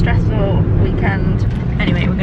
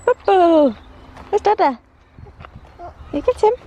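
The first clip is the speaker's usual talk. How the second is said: high cooing to a little one; 10 semitones higher than usual. Real, not acted. Mum sending toddler after Dada, high playful coo.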